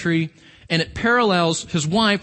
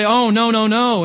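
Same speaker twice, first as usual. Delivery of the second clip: louder, mock-dismayed "Oh, no, no, no"; mock protest; 3 semitones higher, 6 dB louder than usual. Believers protesting hardship, mock loud dismay. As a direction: ham up a whiny refusal. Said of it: "we say".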